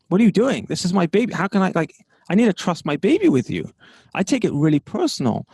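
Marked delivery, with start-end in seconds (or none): 0.1-3.7 exasperated outburst. Retold or imitated. Owner scolding a careless carer, pitched-up exasperation. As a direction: let the frustration burst out, pitched up. Carer mishandles his baby.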